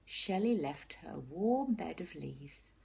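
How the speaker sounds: ordinary delivery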